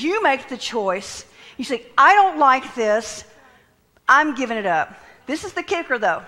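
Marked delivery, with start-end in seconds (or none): 2.0-4.9 loud, emphatic declaration; firm refusal. Acted. Preacher voicing someone choosing to let go, firm refusal. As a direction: declare the choice loudly.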